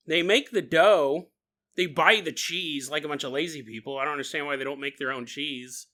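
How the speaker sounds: usual speaking voice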